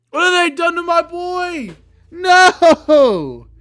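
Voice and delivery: high, loud wail of disbelief; anguished shout. Real, not acted. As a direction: cry out at the ruined design. Reacting to a ruined design again.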